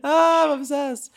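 high, slow gushing; delighted. Real, not acted. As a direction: swoon over the answer. Delighted reaction.

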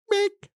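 very high electronic bink; high beep. Retold or imitated. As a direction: chirp it like a thermometer beep. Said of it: "and it goes".

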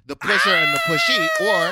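high, loud, theatrical announcer voice; dramatic announcer. Acted. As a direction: hype it up like a showman.

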